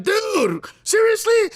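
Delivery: high-pitched mock shout of disbelief; shocked disbelief. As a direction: imitate someone yelping in incredulous shock. Retold shocked reaction on phone.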